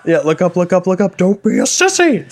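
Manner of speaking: high, rising self-pep-talk against tears; strained, climbing mock plea to himself; mock holding back tears. Jilted boyfriend telling himself not to cry, mock holding back tears.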